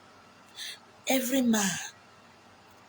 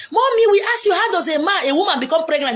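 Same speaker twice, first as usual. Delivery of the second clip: put-on child's voice; 7 semitones higher, 5 dB louder than usual. Children questioning mother.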